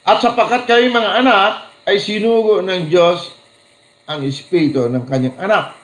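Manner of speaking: scripture reading. Acted. Preacher reading Galatians to the congregation, proclaiming. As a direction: proclaim the verse to the church. Reads Bible verse aloud.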